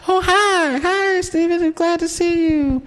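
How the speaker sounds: very high, sugary mock greeting voice; fake-friendly greeting. Interviewers greeting Steven with fake cheer, falsetto mock. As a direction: gush a fake welcome in falsetto. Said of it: "they come around".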